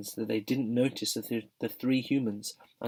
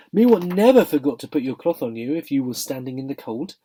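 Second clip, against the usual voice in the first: indignant disbelief; 6 semitones higher, 7 dB louder than usual. A narrator's reading. Cabby hurt his horse forgets him.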